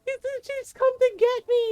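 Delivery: put-on high, panicked shriek of a little boy; mock shrieking. Little brother sure the aswang has come for him, mock shrieking. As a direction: imitate a child shrieking in terror. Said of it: "and he's shrieking".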